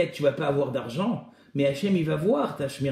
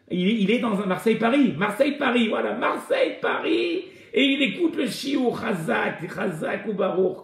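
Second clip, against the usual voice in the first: excited pride; 7 semitones higher than usual. Real, not acted. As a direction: marvel at the friend listening on the train. Excited about his friend on train. (emballé et admiratif, voix qui monte)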